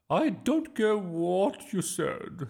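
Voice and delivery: high, loud, drawn-out indignation; snapping. A narrator's reading. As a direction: snap haughtily at the teacher. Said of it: "snapped Miss Boot".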